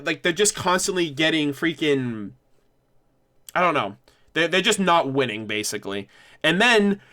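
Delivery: usual speaking voice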